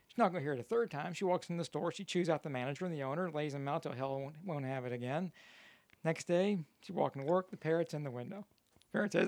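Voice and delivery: ordinary talk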